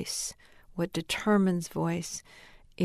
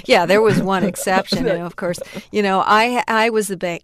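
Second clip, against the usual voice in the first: loud, laughing admission; amused, laughing; 2 semitones higher, 11 dB louder than usual. Real, not acted. Daughter joking about her mother's one exception, laughing. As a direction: say it through a laugh, much louder.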